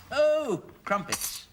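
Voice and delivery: delighted surprise. Acted.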